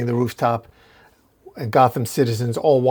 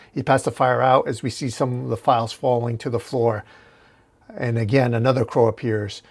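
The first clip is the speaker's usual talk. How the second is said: normal talking voice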